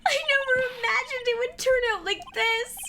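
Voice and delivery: put-on high, frantic young woman; high panicked girl voice.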